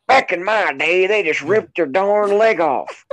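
put-on drawn-out crotchety old man. Mock old-timer bragging.

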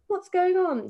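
alarmed gasp. Retold or imitated. Telling her friend how she reacted to the paramedics, in mock alarm. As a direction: gasp and ask it in mock panic. Retelling morphine injection in hotel.

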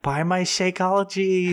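put-on high, slow girly sales pitch; salesy MLM voice. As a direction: pitch it like an influencer. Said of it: "mocking MLM seller pitch".

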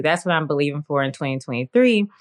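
ordinary delivery

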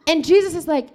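usual speaking voice

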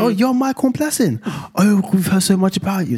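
very high, slow gasping gush; hammed-up churchgoer meeting a celebrity; awestruck fan. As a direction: gasp and gush in mock awe. Mimicking excited churchgoers.

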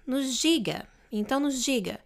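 normal talking voice

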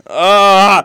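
loud high shriek; short yelp. Real, not acted. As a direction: yelp in mock horror. Mock scream at a sequel.

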